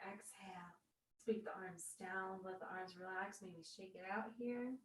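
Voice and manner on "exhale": breathy whisper, no voice; whispered exhale. Real, not acted. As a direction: breathe the word out softly. Breath cue while exhaling.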